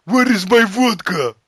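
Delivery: hammed-up Russian asking for vodka; put-on Russian voice. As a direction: play the thirsty Russian. Russian voice again.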